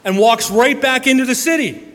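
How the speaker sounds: preacher's triumphant climb; rising excitement. Acted. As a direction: build it up till the city rings out. Preacher climaxes Paul story.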